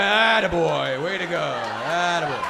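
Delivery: drawn-out loud attaboy; slow praise. Shown as a demonstration. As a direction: stretch each word like a proud pat on the back. Demo of how to praise.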